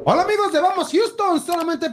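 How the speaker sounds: high bright announcer hello; excited promo greeting. Acted.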